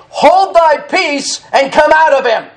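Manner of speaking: commanding rebuke, pitched high; preacher acting out a stern scriptural command; Jesus. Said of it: "And Jesus rebuked him".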